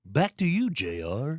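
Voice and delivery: high, loud anchor sign-off; newscaster voice. Pretend. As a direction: throw it back like a TV anchor. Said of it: "talk like a newscaster".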